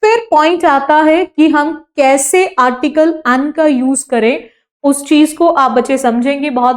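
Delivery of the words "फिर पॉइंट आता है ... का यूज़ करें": ordinary delivery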